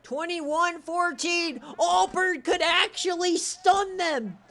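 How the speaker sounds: slow, high, loud score call; excited shouting. Real, not acted. Host announcing Auburn's surprise lead, real excited yell. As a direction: stretch out the upset call in a shout.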